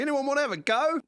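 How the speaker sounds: high, loud, breezy offer; imitating the carefree pilot. Pilot casually offering the band a go at flying, high and loud. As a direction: toss it out like a reckless bush pilot.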